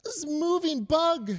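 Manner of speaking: put-on high, slow, whimpering toddler. Father voicing his frightened daughter, high and whimpering. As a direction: say it like a scared little child near tears. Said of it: "she says".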